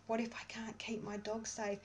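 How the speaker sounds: anxious whisper of What if I can't keep my dog safe; a frightened whisper repeating the worry. Shown as a demonstration. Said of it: "tapping through all your fear".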